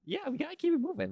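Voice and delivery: normal talking voice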